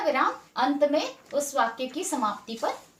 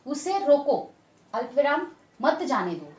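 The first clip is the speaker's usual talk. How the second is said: "उसे रोको" cut off, comma named aloud, then "मत जाने दो"; pause after रोको. Shown as a demonstration. Teacher dictates the second comma placement, pause after रोको.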